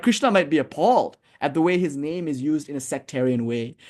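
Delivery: normal talking voice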